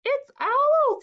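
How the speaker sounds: very high, loud mouse voice; high-pitched storybook mouse; announcing mouse. Acted. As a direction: sing out the news in a little voice.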